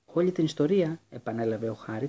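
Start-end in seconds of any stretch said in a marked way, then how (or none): 0.1-1.0 repeated louder, bitter and angry; angry echo. A narrator's reading. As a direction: throw the words back in anger. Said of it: "τα αυτιά βούιζαν από το θυμό".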